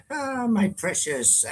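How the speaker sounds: slow high mock Gollum croon. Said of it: "Yes, that's me".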